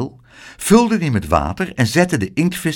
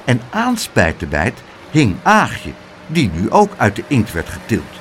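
ordinary delivery

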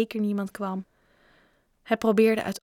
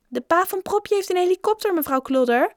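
put-on high, excited child calling out; called from behind; 10 semitones higher, 4 dB louder than usual. A narrator's reading. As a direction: shout the news across the room. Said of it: "riep iemand achteraan". (hoge, roepende kinderstem)

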